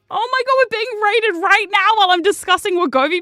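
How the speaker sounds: thrilled by the surprise; excited surprise. Real, not acted. Streamer suddenly noticing a raid, excited.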